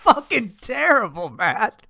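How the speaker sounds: usual speaking voice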